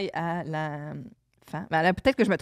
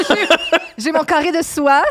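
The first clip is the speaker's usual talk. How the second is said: mock flustered guest; 6 semitones higher, 6 dB louder than usual. Guest voiced in mock scene.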